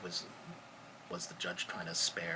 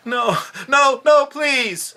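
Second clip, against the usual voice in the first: high, loud terrified begging; desperate pleading; 21 dB louder, much slower than usual. Retold or imitated. Victim begging the beating officers to stop, screamed terrified plea. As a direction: cry out for your life. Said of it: "as Mister Brown's pleading for his life".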